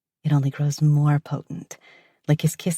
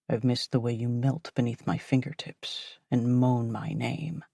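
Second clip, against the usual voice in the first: lower, softer man's voice; low male voice; 5 semitones lower, 4 dB quieter than usual. Acted. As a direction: murmur as the man, low. Narrator voices lover.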